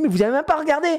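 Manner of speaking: high, indignant patient whine; mock outrage. Dad playing patient protesting to daughter-doctor, high mock outrage. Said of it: "Je dis".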